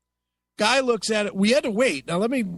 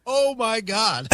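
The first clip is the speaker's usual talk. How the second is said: high, drawn-out appalled reaction; shocked disbelief; 6 semitones higher, slower than usual. Real, not acted. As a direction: stretch it out, higher, aghast and amused. Reacting to shocking clip just played.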